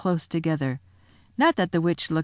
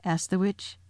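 ordinary delivery

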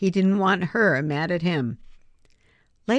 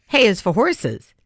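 quoted comeback; 5 semitones higher, 6 dB louder than usual. Retold or imitated. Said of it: "you could have said".

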